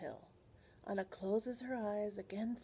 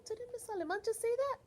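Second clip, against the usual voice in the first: ordinary delivery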